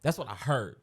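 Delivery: usual speaking voice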